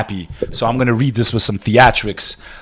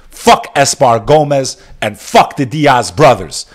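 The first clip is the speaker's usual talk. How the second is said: ordinary talk, nothing marked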